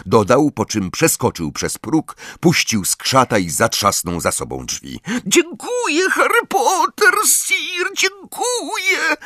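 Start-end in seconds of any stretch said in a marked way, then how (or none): 5.3-9.2 very high, tearful screech; Zgredek. A narrator's reading. Grateful elf thanks Harry, high screech. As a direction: squeal thanks in a tiny elf voice. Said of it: "zaskrzeczał Zgredek".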